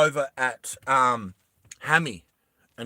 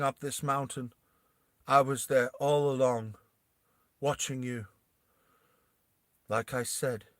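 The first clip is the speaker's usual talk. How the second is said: softer, menacing calm; cold queen; 6 dB quieter than usual. Acted. Queen Bella coolly warning the soldiers, softer. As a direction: quietly threaten them from above. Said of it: "Queen Bella ... she goes".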